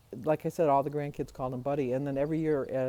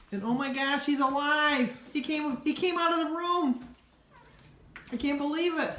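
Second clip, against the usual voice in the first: much higher, louder, thrilled exclamation; excited surprise; 10 semitones higher, 4 dB louder than usual. Real, not acted. Grandma sees grandson leave his room, real excited surprise. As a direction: burst out as if he just appeared.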